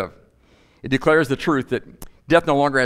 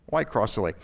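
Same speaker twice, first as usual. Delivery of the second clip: lower, softer echo of a question; quiet repeat; 4 semitones lower, 6 dB quieter than usual. Real, not acted. Preacher letting the question sink in, quietly. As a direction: drop the voice low and soft, as an afterthought.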